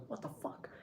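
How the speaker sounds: hushed, stunned near-whisper; retold whispery disbelief. Retold stunned reaction to photos.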